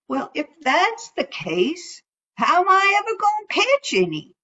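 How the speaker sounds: high fretful raccoon; anxious, high and quick; worried critter voice. Acted.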